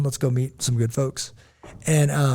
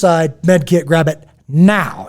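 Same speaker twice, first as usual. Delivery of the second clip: higher and louder, calling out fast orders; urgent shout; 3 semitones higher, 8 dB louder, slower than usual. Retold or imitated. Dad shouting to a bystander to grab the med kit. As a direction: call across to the guy with urgency.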